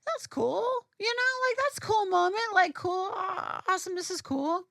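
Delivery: high-pitched, lightly acted "cool"; mock easygoing approval, pitched well up; casual approval. Fan recalling how celebrities left them unfazed, casual approval. Mimics mild reaction to celebrities.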